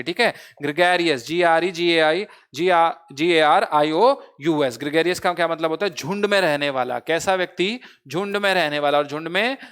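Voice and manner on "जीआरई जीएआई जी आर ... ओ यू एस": slow, in chunks, a pause between letter groups; broken-up spelling. Shown as a demonstration. Teacher breaking gregarious into chunks for students. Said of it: "break कर लीजिए".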